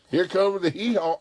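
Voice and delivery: high, loud schoolyard taunt; mocking taunt. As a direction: jeer it like a heckler. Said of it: "people would make fun of us".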